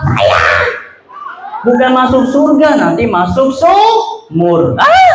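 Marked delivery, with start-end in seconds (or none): 4.8-5.1 falling cry. Acted. Child falls into well.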